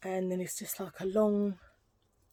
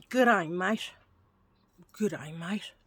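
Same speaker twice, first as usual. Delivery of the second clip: cod Australian catchphrases; bad Australian accent. Vlogger showing off her accents, terrible Australian accent. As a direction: ham up an amateur Australian accent. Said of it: "terrible Australian accent".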